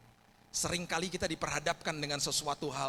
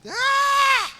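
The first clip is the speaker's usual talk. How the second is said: loud high scream of shock; shouting; 15 dB louder than usual. Retold or imitated. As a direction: scream it out in horror. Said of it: "papanya menjerit".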